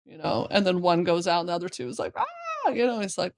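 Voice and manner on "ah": very high imitated cry; short squeal of a crying kid; mock child's wail. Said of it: "they're all just, like, crying".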